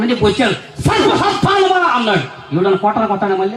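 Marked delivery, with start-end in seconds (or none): 0.8-1.9 high, drawn-out denunciation; thundering prophet calling out sinners, pitched high; harsh prophet's rebuke. Retold or imitated. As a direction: cry it out at the crowd, stretching the words. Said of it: "ఆయన ఎలా మాట్లాడుతుందో తెలుసా".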